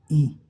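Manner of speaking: "ई" said the mistaken way; wrong way demo. Shown as a demonstration. Teacher shows students the wrong pronunciation. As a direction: model the error on "ई". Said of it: "लेकिन ये गलत है".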